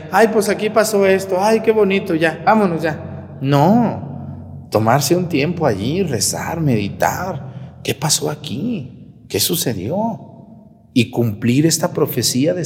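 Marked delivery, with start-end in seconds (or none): 0.1-3.4 high, breezy mock tourist gushing. Priest mocking pilgrims who rush through holy sites, high breezy voice. 7.8-10.9 soft, slow wondering questions; reflective. Shown as a demonstration. Priest showing pilgrims how to reflect, soft and slow. Models prayerful reflection at sites.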